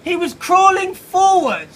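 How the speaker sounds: high and loud, slowly drawn out; loud, slow, high mock narration; mock grand announcement. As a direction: ham it up with a booming high voice. Mimicking a crawling caterpillar.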